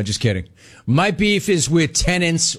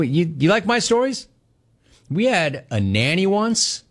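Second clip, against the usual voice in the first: normal talking voice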